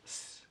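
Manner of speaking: soft mock hiss of a line going taut; tiny sound effect hiss; hissing rope sound. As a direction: breathe out a quiet hiss.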